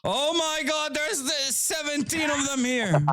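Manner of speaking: excited shout. Real, not acted. Swarm of brutes appears.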